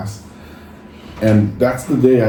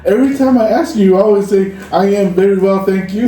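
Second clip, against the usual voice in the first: teasing classmate; 8 semitones higher than usual. Retold or imitated. BYU classmate teasing his stock reply, higher and laughing. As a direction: rib him about his stock answer. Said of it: "They go".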